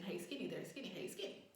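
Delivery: soft, low-key call; imitated low calling. Trying to wave at Skinny, imitated low calling. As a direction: keep the greeting under the breath, as retold. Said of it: "if I said it kinda low".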